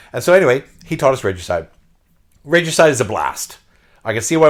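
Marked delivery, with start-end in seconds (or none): none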